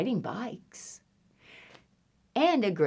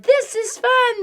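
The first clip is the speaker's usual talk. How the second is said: high, gleeful exclamation; delighted animals; 4 dB louder than usual. Acted. Animals ride around the barnyard, high glee. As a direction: pitch it right up with joy.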